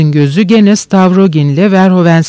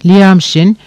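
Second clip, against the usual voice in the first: ordinary delivery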